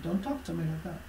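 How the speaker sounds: soft, dangerous low voice; quiet, restrained threat to the yelling supervisor; low quiet warning. Retold or imitated. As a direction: say it quietly, holding back anger. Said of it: "lower their voice and talk to you like that".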